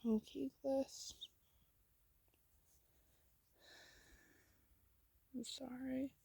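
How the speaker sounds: softly, almost under the breath; a small, quiet apology. A narrator's reading. To Glisten, apologising after the bullies, said quietly. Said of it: "you quietly say".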